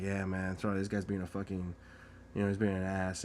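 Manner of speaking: low, soft apology; acted low-key sympathetic cop; imitated confiding cop. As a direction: confide it quietly, man to man. Imitating cop quietly apologizing for the driver.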